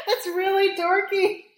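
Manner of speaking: high, loud embarrassed laugh. Real, not acted.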